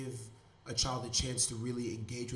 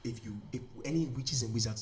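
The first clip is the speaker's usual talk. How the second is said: put-on a lighter Nigerian mother accent, brushing him off; Nigerian mom accent. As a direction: mom dismisses the library excuse, Nigerian accent. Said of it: "And my mom is like".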